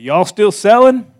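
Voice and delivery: put-on old Missouri gentleman asking y'all still selling, slowly; Missouri drawl. Old man asking about the house, Missouri accent. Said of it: "That's how they talk in Missouri".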